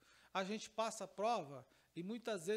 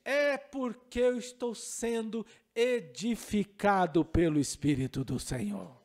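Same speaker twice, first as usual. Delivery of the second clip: loud, slow, earnest declaration; preacher declaring he is being edified; fervent reply; 2 semitones lower, 8 dB louder than usual. Retold or imitated. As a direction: proclaim it to the boy with conviction. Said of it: "Aí eu falei assim".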